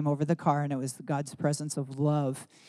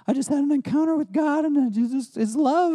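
high, louder, tearful voice breaking through; imitating her own sobbing words, high and louder; crying while speaking; 11 semitones higher, 5 dB louder than usual. Tearful retelling after an encounter.

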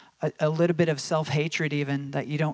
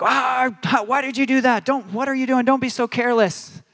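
higher, louder exasperated scolding; scolding parent; 9 semitones higher, 7 dB louder than usual. Retold or imitated. A parent scolding his daughter over the broken glass. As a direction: snap at the careless child.

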